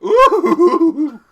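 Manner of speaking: a high, loud, strange coo; odd cooing. Acted. Lord cooing from inside the train, odd high coo. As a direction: coo like a flamboyant bird. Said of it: "the oddest cooing".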